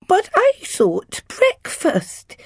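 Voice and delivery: put-on very high, slow, wounded toddler; small boy's plaintive protest, drawn out and high; hurt voice. A narrator's reading. Confused Jack answers his cross dad, in a hurt child's voice. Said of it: "he said in a hurt voice".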